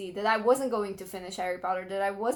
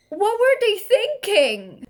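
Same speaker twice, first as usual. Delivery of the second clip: much higher and louder, slow disbelief; exasperated outcry; 10 semitones higher, 7 dB louder, slower than usual. Real, not acted. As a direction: throw it up to the sky in outrage. Rant about bad audiobook casting.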